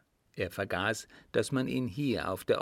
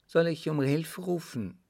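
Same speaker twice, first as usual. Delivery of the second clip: higher-pitched female character; lightly voiced woman; 7 semitones higher than usual. A narrator's reading. A woman warning her insistent suitor, higher voice. Woman threatens to call help.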